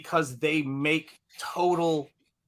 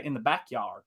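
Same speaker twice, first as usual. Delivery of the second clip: Southern accent; 3 semitones lower, 6 dB quieter than usual. Shown as a demonstration. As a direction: say backyard the Southern way. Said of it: "enunciate things on opposite sides".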